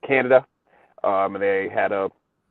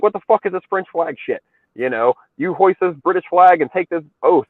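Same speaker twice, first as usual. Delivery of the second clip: higher-pitched retold exasperation; mock demand; 7 semitones higher, 4 dB louder than usual. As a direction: lay down the law in mock annoyance. Voicing bossy English envoy.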